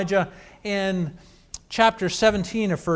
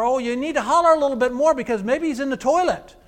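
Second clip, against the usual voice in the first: fast, higher and louder jeering; mocking taunt; 6 semitones higher, 5 dB louder, faster than usual. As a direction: rib them mercilessly, almost laughing. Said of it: "he started making fun of him".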